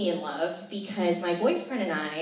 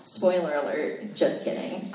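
ordinary delivery

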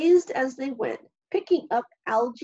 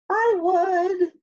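lanternfish voice; 8 semitones higher, 5 dB louder than usual. A narrator's reading. Lanternfish answers the crab.

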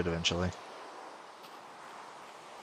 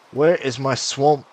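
exasperated call; 6 semitones higher, 9 dB louder than usual. Real, not acted. Searching for swamp.

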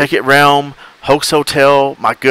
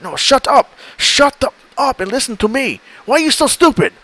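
much higher, furious shrieking yell; angry scammer; 13 semitones higher than usual. Retold or imitated. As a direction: blow up at the idiot. Scammer yelling at the baiter.

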